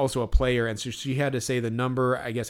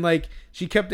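normal talking voice

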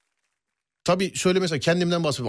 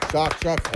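spoken clapping. Pretend. Host claps for listeners by saying şak şak. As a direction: mimic applause with your mouth. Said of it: "Daha hızlı".

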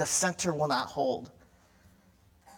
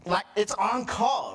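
usual speaking voice

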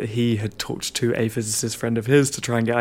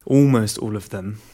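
"almost" hit louder, the rest easing off; stress on ALMOST; 6 dB louder than usual. Real, not acted. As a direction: lean hard on the word "almost". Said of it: "emphasis on the word almost".

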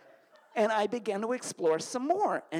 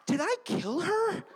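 much higher frantic fear; high panicked worry; 13 semitones higher than usual. Retold or imitated. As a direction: squeal it in sheer panic. Panic alone at home.

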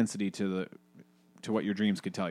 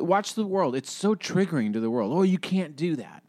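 high, mocking scandalized voice; high mock outrage; mocking outraged people; 8 semitones higher than usual. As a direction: parody the pearl-clutchers. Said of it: "everybody's like".

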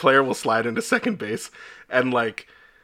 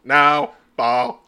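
flat robot call; deadpan machine ruling; 4 dB louder than usual. Retold or imitated. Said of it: "robot's just like".

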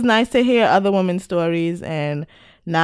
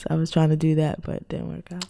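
normal talking voice

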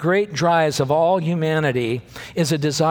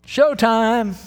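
excited announcement; 7 semitones higher, 3 dB louder than usual. Real, not acted. Preacher announcing the big moment.

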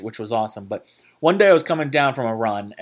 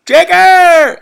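shouted name, much higher and louder, across the slope; calling out loud; 14 dB louder than usual. Retold or imitated. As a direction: yell the name from far up the hill. Friend calls out across slope.

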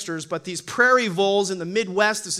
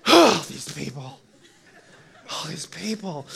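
drained exasperation; 6 semitones lower than usual. Acted. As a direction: sigh it out, worn out by company. Sighing, drained by being around people.

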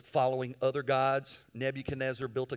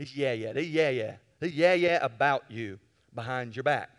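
gossip mimicry; 2 semitones lower than usual. Shown as a demonstration. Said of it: "that's what I call it".

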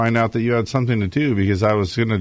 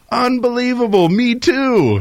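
much higher, louder mock disbelief; amazed joke character; 4 dB louder than usual. Irish drinker hears they share a hometown, hammed-up amazement. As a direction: act out delighted astonishment at the coincidence.

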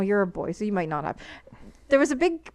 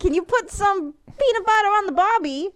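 higher, hammed-up Australian accent; Aussie accent; 8 semitones higher than usual. Shown as a demonstration. Showing off a bad Australian accent for the podcast. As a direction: play the cheerful Aussie tourist. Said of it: "Now say".

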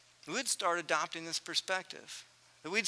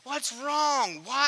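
much higher and louder, slow furious scolding; losing it; 8 semitones higher, 4 dB louder than usual. Retold or imitated. Dad losing it with his boys over bedtime, furious. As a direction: let the anger boil over at them. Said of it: "just lose it".

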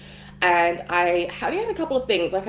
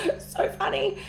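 laughing it out, high and giggly; 12 semitones higher, 3 dB quieter than usual. Real, not acted. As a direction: giggle through the words. Laughs at own story.

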